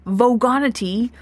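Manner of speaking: ordinary delivery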